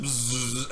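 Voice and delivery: imitated insect buzz; wasp buzz. Gamer imitating the wasp in his room, wasp buzz. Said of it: "and he's like".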